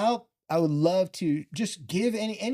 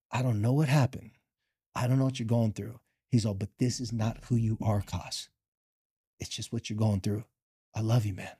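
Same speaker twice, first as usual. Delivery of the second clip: coach's tender words; 6 semitones lower than usual. Retold or imitated.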